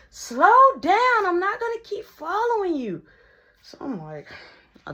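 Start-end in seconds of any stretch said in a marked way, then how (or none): 0.1-3.0 high, loud call from behind. Retold or imitated. Sydney calling after him to slow down, high and loud. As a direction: shout after someone walking away.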